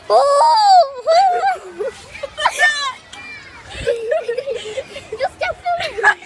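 high, loud shrieking laugh; laughing hard. Real, not acted. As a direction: burst out laughing, squealing. Laughing at the friend's joke.

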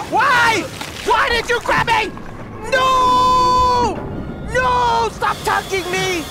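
high, loud desperate shouts; panicked screaming. Real, not acted. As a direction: yell in real terror as he grabs you. Grabbed by a monster in game.

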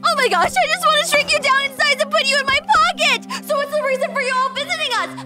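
fast, breathless-with-delight rush; excited gushing. Acted. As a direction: babble in a delighted torrent. Fangirl gushing at alien.

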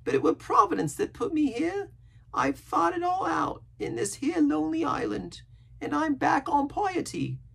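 very high, earnest sailor voice; pious rambling castaway. A narrator's reading. Castaway telling Jim of his pious youth, earnest.

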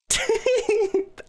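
squealing high laughter. Real, not acted. Laughing at the ending.

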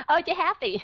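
imitating a student's loud dismayed cry; playful mock dismay; mock exclaim. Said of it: "Hồi nãy anh nói".

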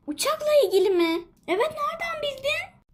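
ordinary delivery

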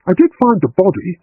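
biting back at his remark; snapped. A narrator's reading. Agatha snaps back at John, irritated. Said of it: "she snapped".

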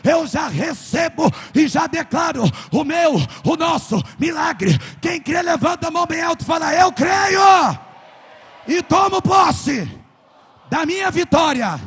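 high, loud shouted prayer; fiery preaching prayer. Acted. Pastor leading the church in prayer, shouting at full force.

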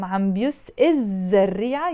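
الزريعة linked onto عم بيس without its initial vowel; liaison. Shown as a demonstration. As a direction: run بيس straight into a double Z in الزريعة. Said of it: "putting this liaison from". (join عم بيس الزريعة as one phrase, ending bios-zzarria)

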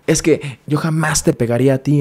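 high-pitched, imitated frantic self-defence; agitated protest. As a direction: mimic someone worked up and protesting. Acting out boyfriend's frantic outburst.

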